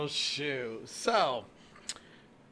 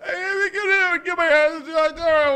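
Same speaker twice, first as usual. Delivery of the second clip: high, loud mock buddy voice; imitating a buddy; 11 semitones higher, 8 dB louder than usual. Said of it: "He, he rolls that out there".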